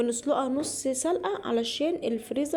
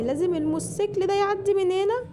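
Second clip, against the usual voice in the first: raised annoyed voice; 10 semitones higher, 5 dB louder than usual. Real, not acted. Annoyed at passing motorcycle noise.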